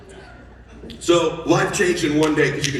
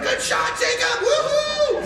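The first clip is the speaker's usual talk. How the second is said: high, stretched-out woohoo; excited kid cheer; slower than usual. Retold or imitated. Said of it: "He's like".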